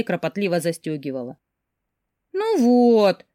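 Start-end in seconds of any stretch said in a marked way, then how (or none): 2.3-3.2 high, loud, drawn-out reproach; reproachful drawl. A narrator's reading. Sister reproaches her for changing already, reproachful drawl. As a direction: stretch it out in disappointment. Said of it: "сукоризной протянула сестра".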